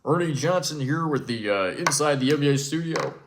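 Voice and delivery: a hint of a smooth studio host; lightly voiced studio anchor throwing to a guest; Ernie Johnson impression. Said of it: "We send it down to Ernie Johnson".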